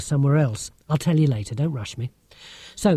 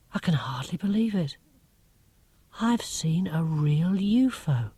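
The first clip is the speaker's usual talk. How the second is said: wide-eyed whisper; stunned whisper; 2 semitones higher, 5 dB quieter than usual. Acted. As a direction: breathe it out as if afraid to say it aloud. Narrator whispering in disbelief.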